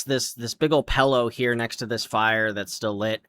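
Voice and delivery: ordinary talk, nothing marked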